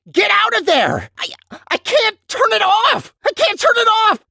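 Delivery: frantic high cries; panicked shouting. Acted. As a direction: yell a warning in panic. Alarm as test goes wrong.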